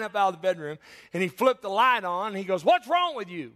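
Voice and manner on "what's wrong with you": loud, high, exasperated mock yell; gruff-tempered grandpa. Grandpa woken by a screaming grandchild, acted loud exasperation. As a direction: demand it like an irritated grandfather. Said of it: "Very loving, very tenderly loving".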